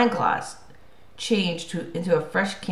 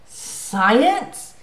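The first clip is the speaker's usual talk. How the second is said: louder, higher scornful question; scoffing; 5 semitones higher, 4 dB louder than usual. A narrator's reading. Mister D throws the boy's word back at him, scoffing. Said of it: "Mister D scoffed".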